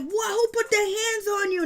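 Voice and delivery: mock surprise. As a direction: act shocked and ready to fight. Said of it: "And Ashley's like".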